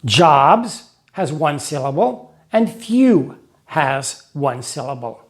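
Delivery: slow, deliberate teacher pacing; slow explaining. Shown as a demonstration. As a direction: spell it out slowly for learners. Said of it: "invent a way of remembering this".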